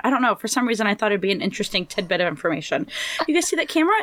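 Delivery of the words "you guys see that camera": put-on higher-pitched, quick question to children; lightly re-enacted babysitter drawing kids' attention; pointing out to kids. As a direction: get the kids to look, voice lifted.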